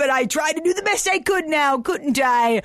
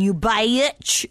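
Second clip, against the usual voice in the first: normal talking voice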